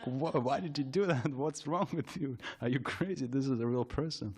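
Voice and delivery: a hint of a man telling her off; retold yelling. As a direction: imitate an exasperated man scolding her. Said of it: "why are you yelling at me".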